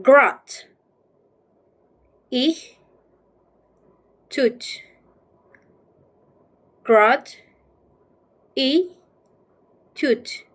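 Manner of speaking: "Grat. I. Tut." as isolated beats with long pauses; syllable by syllable. Shown as a demonstration. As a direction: sound out "Grat", "I", "Tut" one at a time. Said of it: "How can we read?".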